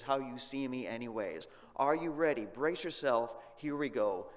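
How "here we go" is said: ordinary talk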